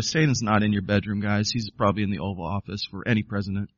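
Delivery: dropped-voice quip. Real, not acted.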